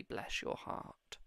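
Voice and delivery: slow, breathy Southern "Bless your heart"; whispered southern accent. Retold or imitated. Psychic imitates a relative's gentle Southern "Bless your heart". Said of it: "they said it in the most southern way you could say".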